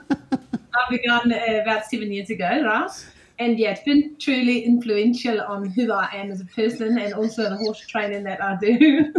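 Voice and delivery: South African accent, giggle threatening. Shown as a demonstration. Guest describing trauma work to host, playful South African accent. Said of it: "That's your African accent".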